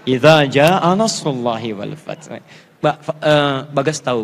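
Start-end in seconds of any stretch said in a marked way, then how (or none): 0.1-2.3 slow, lower chanted recitation; slow Quran chant. Shown as a demonstration. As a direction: recite An-Nasr slowly as a model. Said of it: "Minta dibaca An-Nasr".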